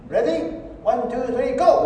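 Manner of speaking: lightly voiced teacher calling the start; German teacher. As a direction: count the class in like a teacher. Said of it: "老师一来说".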